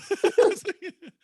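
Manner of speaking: high, laugh-filled punchline; laughing through words. Real, not acted. Comic topping his relief-bag joke for his friend, laughing as he speaks. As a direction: crack up while adding the punchline.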